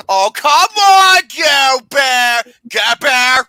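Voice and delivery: loud, high, laughing shouts; shouting through laughter. Real, not acted. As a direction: yell it out while cracking up. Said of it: "I think you broke him".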